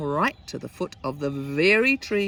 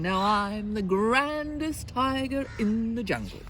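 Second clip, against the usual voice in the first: higher, swelling storybook boast; boastful tiger; 5 semitones higher than usual. Acted. Tiger parading off with boy's umbrella, high proud boast. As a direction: crow it proudly, riding high on GRANDEST.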